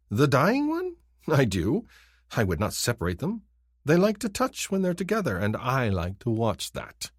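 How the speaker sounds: high, gloating creep voice; creepy leering villain. Acted. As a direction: savor it like a creep. Kinfar's creepy reply.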